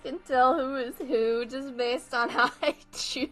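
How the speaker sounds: dissolving into laughter; real laughing. Real, not acted. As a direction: talk until the giggles take over. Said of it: "I'm laughing".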